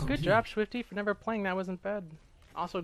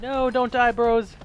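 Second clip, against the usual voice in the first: higher, louder pleading; urgent shout; 7 semitones higher, 6 dB louder, slower than usual. Real, not acted. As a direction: yell at them not to die. Urgent plea to teammates.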